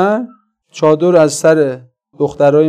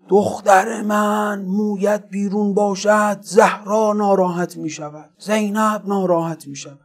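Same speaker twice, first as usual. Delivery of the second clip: mock shouting preacher; 5 semitones higher, 3 dB quieter than usual. Admirer mimicking a preacher scolding about hijab, mock shouting. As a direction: imitate an impassioned preacher, stretching the words.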